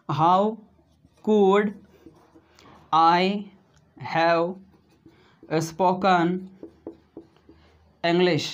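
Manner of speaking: very slow, stretched English; teacher modelling slowly; slow model sentence. Shown as a demonstration. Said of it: "तो क्या बना दोस्तों?".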